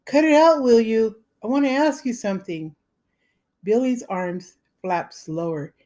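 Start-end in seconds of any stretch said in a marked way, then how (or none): 0.1-2.8 higher, louder, then rushing fast; hearty, quavering plea. Acted. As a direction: bluff cheer hiding fear. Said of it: "in a hearty, quavering voice".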